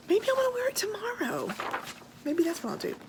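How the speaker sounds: airy high-pitched daydream; playful high musing. Real, not acted. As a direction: toss it off in a sing-song. Playful musing about wearing it.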